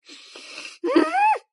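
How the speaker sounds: high, rising cry; tearful wail. Real, not acted. Viewer overcome by the hitch in his voice, tearful wail. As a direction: let out a high whimper of heartbreak.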